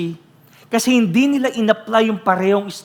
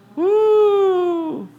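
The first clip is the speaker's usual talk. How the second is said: high excited woo; exclaimed woo; 5 dB louder than usual. Real, not acted. Preacher catching himself mid-rant, high whoop. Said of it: "I almost said a bad word".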